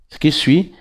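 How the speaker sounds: usual speaking voice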